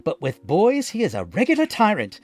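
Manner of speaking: high, loud gossiping crowd voice; malicious bystanders blaming Geppetto. A narrator's reading. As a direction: spread the rumour with malice. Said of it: "the others added maliciously".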